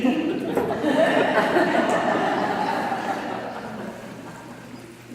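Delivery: high, loud breathy burst of laughter; real laugh. Real, not acted. Laughing at the coincidence.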